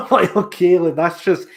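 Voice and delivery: high, loud words through a laugh; real laughter spilling into the words. Real, not acted. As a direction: still laughing as you say it. Laughing after armband remark.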